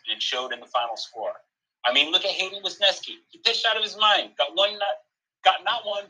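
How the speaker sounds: lightly voiced, emphatic sports pundit; a hint of a ranting TV commentator; Stephen A. Smith. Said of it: "here's what Stephen A. Smith had to say".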